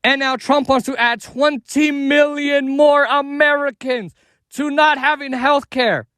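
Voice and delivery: angry rising rant, shouting; building from heated to yelling; escalating comedy rant. Real, not acted. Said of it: "Damn, he's mad".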